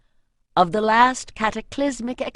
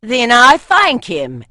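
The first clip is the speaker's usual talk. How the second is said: put-on northern accent; 4 semitones higher, 12 dB louder than usual. A narrator's reading. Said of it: "answered the northerner".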